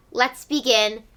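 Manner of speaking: plain reading voice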